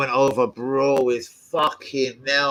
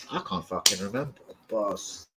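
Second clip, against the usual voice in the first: ordinary talk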